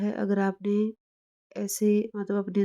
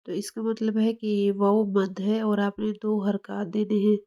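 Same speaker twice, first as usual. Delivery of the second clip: normal talking voice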